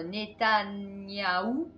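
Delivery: Netanyahou drawn out slowly, syllable by syllable; careful slow name. Real, not acted. Vlogger struggling to say a politician's name, slow careful effort. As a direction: slowly sound out Netanyahou so you get it right. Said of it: "je suis arrivée à le dire".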